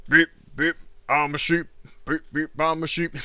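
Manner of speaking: sing-song cartoon quote; cartoon sheep voice.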